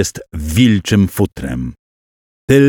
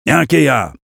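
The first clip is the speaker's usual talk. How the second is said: startled shout; 6 semitones higher than usual. A narrator's reading. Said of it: "krzyknął i wystraszył się siebie".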